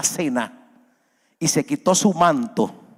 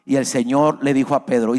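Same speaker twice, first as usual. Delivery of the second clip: ordinary talk, nothing marked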